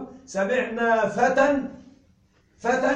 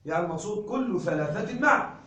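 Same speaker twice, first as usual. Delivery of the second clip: usual speaking voice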